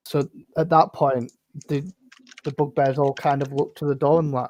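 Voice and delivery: ordinary talk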